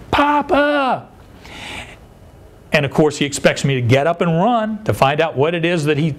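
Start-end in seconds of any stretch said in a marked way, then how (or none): 0.1-1.1 put-on high, drawn-out child's call for grandpa; grandson's yell. Grandson calling his grandfather on waking, imitated high-pitched yell. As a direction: imitate a small boy hollering, pitched way up. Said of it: "he yells".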